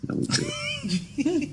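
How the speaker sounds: high squeaky laugh through words. Real, not acted. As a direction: laugh it out, drawn out. Joking about chitlins, laughing.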